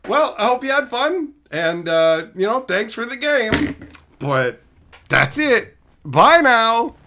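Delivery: high, sing-out goodbye with false cheer; mock-polite send-off. Streamer brushing off an overpowered opponent, mock-polite. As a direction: act out a breezy, final farewell.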